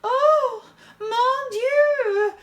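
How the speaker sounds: very high, loud theatrical wail; long, drawn-out melodramatic howl; howling diva. A narrator's reading. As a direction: howl it like a distraught French diva. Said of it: "howls Eva".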